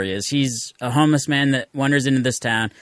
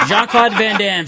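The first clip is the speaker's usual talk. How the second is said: laughing; 10 semitones higher, 3 dB louder, slower than usual. Real, not acted. Laughing while recalling the plot.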